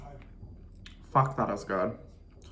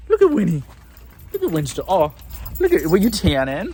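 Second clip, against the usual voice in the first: dog voice; 7 semitones higher, 10 dB louder than usual. Real, not acted. Greeting the dogs excitedly.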